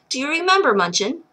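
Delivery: plain reading voice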